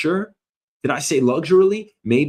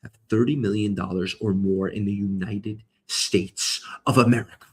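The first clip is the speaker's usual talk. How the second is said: usual speaking voice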